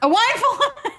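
laughing through the garbled quote; high laughing quote. Real, not acted. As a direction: giggle out the mixed-up line.

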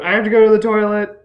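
loud, high, fast blurt; shouting out. Acted. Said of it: "shout at the same time".

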